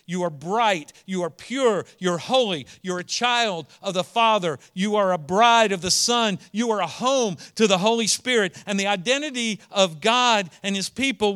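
loud, ringing declarations, each line landing harder; full-force preaching. Acted. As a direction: proclaim it, building to a shout.

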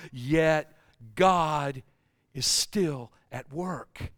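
slow, weighted, falling close; solemn preaching. Real, not acted. Preacher assures the suffering that God works on, solemn preaching. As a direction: let the refrain settle, softening to the end.

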